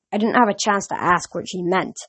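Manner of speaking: usual speaking voice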